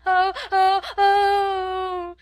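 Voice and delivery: high, loud drawn-out wailing "oh"s; child's howling wail. A narrator's reading. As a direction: bawl like a tantrum-throwing little girl. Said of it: "Lottie howled tempestuously".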